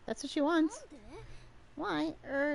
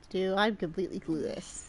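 low dopey voice; 7 semitones lower than usual. Acted. Character admits confusion.

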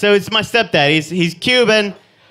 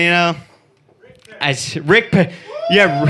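usual speaking voice

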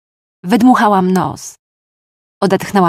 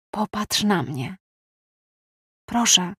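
soft plea; 7 dB quieter than usual. Acted. Will softly begs Clark to look.

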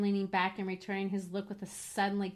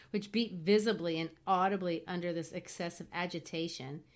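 ordinary delivery, nothing marked

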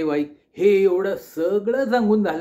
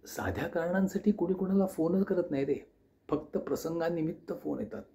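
put-on soft elderly aunt; 6 dB quieter than usual. Aunt speaking on phone.

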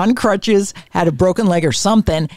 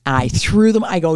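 normal talking voice

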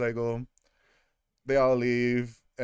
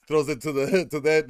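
ordinary talk

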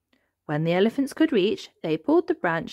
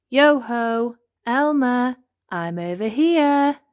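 raised, drawn-out call from afar; 6 semitones higher, 5 dB louder, slower than usual. A narrator's reading. Cousin calls to Elmer from hiding, calling out. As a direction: sing it out to be found.

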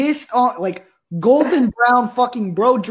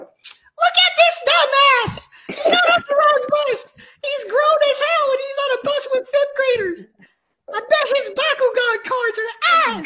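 put-on high, shrieking kid voices roasting, laughing; cackling grade-schoolers jeering at the big kid; mocking fifth graders. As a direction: squeal insults like bratty little kids. Fifth graders roasting him on bus.

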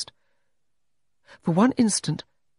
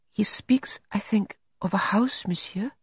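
gentle, detached, soft high voice; hushed, higher-pitched sister of charity; soft-voiced nun; 7 semitones higher than usual. A narrator's reading. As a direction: speak softly, with gentle detachment. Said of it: "The soft-voiced sister of charity".

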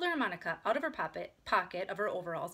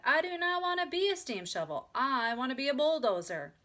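higher, declaring voice; storybook character; 5 semitones higher, 5 dB louder than usual. A narrator's reading. Sister reads the book aloud, declaring. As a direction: declare it as a little steam shovel.

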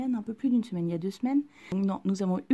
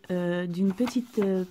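ordinary delivery

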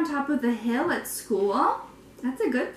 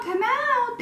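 softer call; 10 semitones higher than usual. Acted. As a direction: call again, trailing off. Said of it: "they called".

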